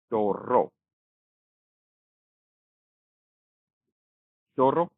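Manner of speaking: टोरो with a longer trilled r; rolled double r. Shown as a demonstration. Teacher showing the stronger rolled r in टोरो. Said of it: "र की जो, अ, rolling है वो थोड़ी सी बढ़ जाती है".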